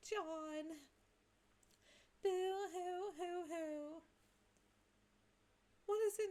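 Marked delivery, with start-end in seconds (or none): 2.2-4.0 slow hammed-up boo-hoo sobs; mock sobbing. Jade Sprite wails over forgotten John, hammed-up sobbing. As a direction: blubber it out like a cartoon crybaby. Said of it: "I'm no longer gonna do Jade Sprite crying".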